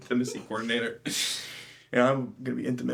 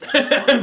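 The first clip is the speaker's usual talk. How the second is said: loud laugh; 11 semitones higher, 8 dB louder than usual. Real, not acted. Laughing at own story.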